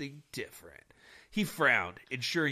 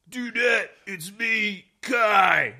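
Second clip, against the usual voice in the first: raised voice through a closed door; calling through a door; 4 semitones higher, 6 dB louder, much slower than usual. Acted. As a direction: call out to someone behind a door. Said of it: "he called through the door".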